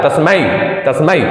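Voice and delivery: तस्मै with a clear आई diphthong at the end. Shown as a demonstration. Teacher stressing the ai vowel in तस्मै. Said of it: "आई, आई आ रहा है".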